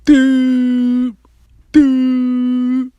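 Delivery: high, held "doop" dial tone; phone ringing noise. Retold or imitated. Storyteller mimicking the call to the ex, imitated ringing. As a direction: imitate a ringing line with long level toots. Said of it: "So I'm calling".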